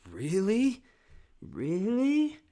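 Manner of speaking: high, loud taunting Really; mocking mugger. Mugger taunting scared kids at McDonald's, high mocking yell. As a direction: sneer it up high and loud, slow on That's all you got. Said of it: "they went".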